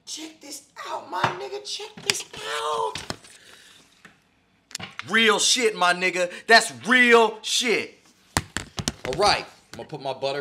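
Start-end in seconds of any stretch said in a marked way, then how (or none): 0.1-2.9 excited high showoff. Acted. Cooking host shows viewers melted butter, slow rising high voice. 5.1-8.0 loud emphatic. Acted. Emphatic sign-off.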